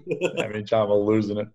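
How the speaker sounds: laughing so hard the words wobble. Real, not acted. Laughing with the host over the flight story. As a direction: still cracking up at the story.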